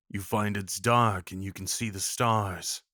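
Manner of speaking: normal talking voice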